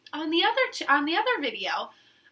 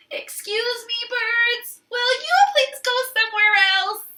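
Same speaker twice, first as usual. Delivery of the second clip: higher, careful polite request; polite Elephant; 8 semitones higher, 5 dB louder than usual. Acted. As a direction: Elephant asking the birds nicely. Elephant politely asks birds.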